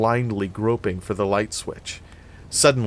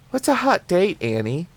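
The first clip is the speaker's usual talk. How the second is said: put-on high, curious child's voice; little kid; 10 semitones higher than usual. A narrator's reading. Kid asks Annie as the doors close, high child's voice. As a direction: ask like a puzzled small child, pitched high. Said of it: "he heard the kid".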